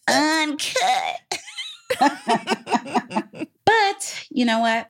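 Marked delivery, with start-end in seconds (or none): 0.1-1.4 higher, shouted hype word; excited shouted uncut. Real, not acted. 1.4-3.4 giggling burst of laughter; real laughing fit. Real, not acted. Laughs after uncut.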